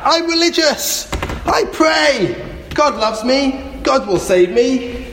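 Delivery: high, drawn-out call in reply; shouting back. Acted. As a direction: call back with pious certainty. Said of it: "The man shouted back".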